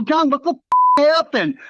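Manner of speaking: a touch of startled disbelief; shocked question. Retold or imitated. Blood just hit his face.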